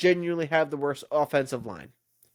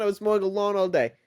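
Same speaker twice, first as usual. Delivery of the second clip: ordinary delivery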